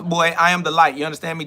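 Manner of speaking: normal talking voice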